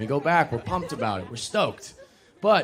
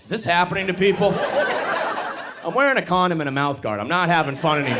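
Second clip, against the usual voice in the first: loud, higher exasperated complaint; 5 semitones higher, 5 dB louder than usual. Acted. Comic complaining about rough hookups, loudly exasperated. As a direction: protest it loudly to the room.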